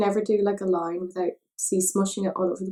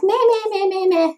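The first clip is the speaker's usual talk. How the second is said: much higher, louder whiny meh; 12 semitones higher, 6 dB louder than usual. Retold or imitated. Mocking her own laziness, high whiny meh. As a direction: whine like a bored kid. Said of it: "I'm already getting all like".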